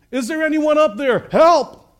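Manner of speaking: high, loud acted call upward; crying out for help. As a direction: call out desperately for any rescuer. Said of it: "Jack began to cry out for help".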